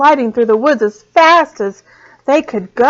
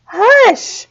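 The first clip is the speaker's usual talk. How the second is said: loud hush; 12 semitones higher, 5 dB louder than usual. A narrator's reading. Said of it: "said the squirrel and the fox".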